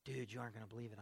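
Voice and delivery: lightly mimicked dude talk, low and soft; a touch of mellow dude disbelief; laid-back dude. Friend reporting back about K-Love, lightly imitated laid-back dude. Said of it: "people still talk like that".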